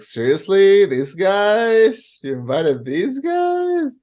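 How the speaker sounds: high, scornful disbelief at the guests; incredulous disdain. Retold or imitated. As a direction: stretch out "You invited these guys?" in disgust. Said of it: "I would be like".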